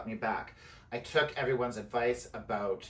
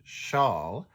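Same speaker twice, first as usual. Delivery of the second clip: very slow, deliberate enunciation; slow, careful; much slower than usual. Real, not acted. Knitter having trouble saying the shawl's name, very slow and careful. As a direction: pick carefully through a tricky name. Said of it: "I'm having trouble saying it".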